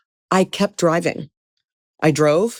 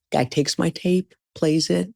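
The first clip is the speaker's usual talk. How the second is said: normal talking voice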